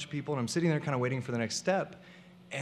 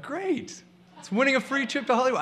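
excited; 10 semitones higher, 4 dB louder than usual. Retold or imitated. Thrilled reaction to the free trip.

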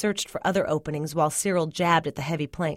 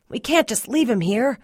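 higher, strained plea; urgent protest, a touch of wheeze; wheezed; 5 semitones higher, 3 dB louder than usual. A narrator's reading. Cyril refusing to leave Melvin, wheezed. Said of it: "Cyril wheezed".

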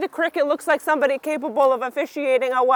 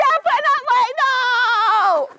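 shouting; 9 semitones higher than usual. Real, not acted. Answering kids' shouted no.

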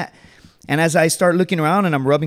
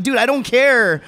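exasperated retort; 7 semitones higher, 4 dB louder than usual. Acted. Handcuffed Marine answering buddy's excuse, exasperated. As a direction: cut off his excuse, fed up.

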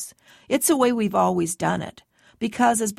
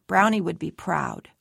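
ordinary delivery, nothing marked

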